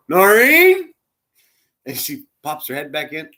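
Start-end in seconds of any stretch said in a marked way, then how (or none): 0.1-0.9 high, loud call across the room. Retold or imitated. Old husband calls out to his leaving wife, high and loud. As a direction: call her name to catch her at the door. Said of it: "Chuck goes".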